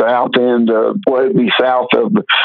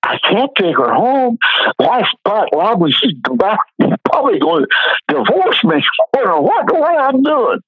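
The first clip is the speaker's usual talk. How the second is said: panicked; 9 semitones higher than usual. Retold or imitated. Retold panicked thought.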